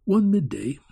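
ordinary delivery, nothing marked

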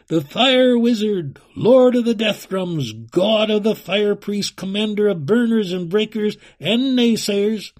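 high, loud, grand announcing voice; herald's proclamation. A narrator's reading. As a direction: proclaim the titles like a royal herald. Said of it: "as a herald's voice proclaimed".

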